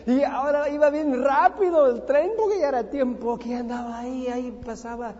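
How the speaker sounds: high, loud, excited panic; panicked retelling of the speeding train; rising alarm. Retold or imitated. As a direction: tell it breathlessly as the ground rushes past. Realizes wrong train, panics.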